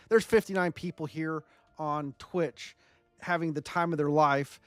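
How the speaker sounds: ordinary talk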